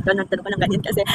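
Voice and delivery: normal talking voice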